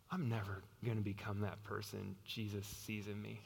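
low, soft resignation; understated despair, voice sunk low; hopeless. Acted. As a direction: give up on yourself quietly. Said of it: "might start to believe".

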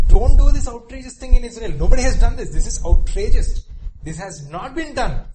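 loud, high pleading reasoning; urgent pleading. Retold or imitated. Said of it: "It's saying".

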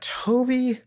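high, startled gasp of a name; a girl caught off guard, squeaking his name; surprised. Retold or imitated. Emily startled to see Toby at the door, surprised squeak. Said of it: "Emily's just like".

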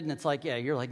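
ordinary talk, nothing marked

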